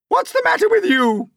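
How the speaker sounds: very high, loud screech; Aunt Spiker screeching. Acted. Aunt Spiker screeching at crying James. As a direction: screech down at the boy. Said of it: "Aunt Spiker screeched".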